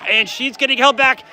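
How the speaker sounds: normal talking voice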